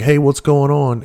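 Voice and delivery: imitating a casual phone pickup; retold phone greeting. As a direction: answer the call with a slow, lifted hello. Answering an unexpected phone call.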